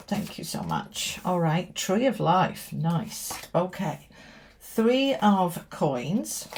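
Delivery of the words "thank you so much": soft, breathy whisper of thanks. Real, not acted. Reader thanking the oracle cards, whispered. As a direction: whisper a private thank-you to the deck.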